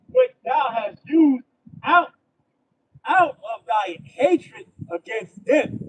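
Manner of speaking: loud, slow, hammered-out preaching; fiery street-preacher declamation; preaching at full force. Acted. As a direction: thunder the verse word by word at them.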